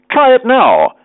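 high, lively urging; eager urging. A narrator's reading. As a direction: egg a friend on brightly. Friend eagerly urging him to slide.

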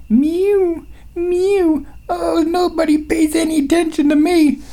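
very high whining kitty voice; whiny cat voice. Retold or imitated. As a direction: meow and complain like a needy cat. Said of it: "outside the door going".

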